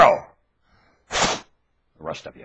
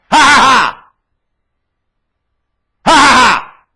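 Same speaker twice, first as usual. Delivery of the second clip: high, loud taunting laugh; mocking laugh; 11 semitones higher, 7 dB louder than usual. Real, not acted. Taunting the viewers, a loud mocking laugh. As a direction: cackle it out at them.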